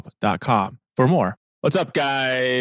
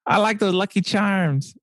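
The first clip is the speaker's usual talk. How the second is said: high-pitched mock Irish accent; 9 semitones higher than usual. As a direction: put on a jokey, high Irish voice. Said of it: "So he's not Irish".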